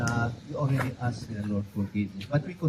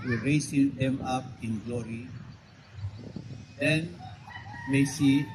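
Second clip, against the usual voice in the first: prayer; 4 semitones higher, 8 dB louder than usual. Acted. Priest leads graveside prayer.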